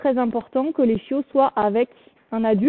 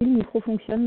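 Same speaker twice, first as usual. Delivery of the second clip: ordinary talk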